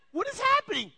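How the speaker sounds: high, loud parental disbelief; bewildered yell. Retold or imitated. Parent watching kids kick and punch, bewildered yell. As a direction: cry out as if chaos has broken loose. Parent reacts to children's brawl.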